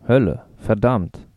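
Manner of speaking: langsam, fremd gefärbt gesprochen; „Hölle, verdammt“ langsam wie in fremder Sprache; fremd klingendes Jotisch. Pretend. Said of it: "Sag doch mal was auf Jotisch". (Schewek spricht der Studentin jotisch klingende Wörter vor, langsam)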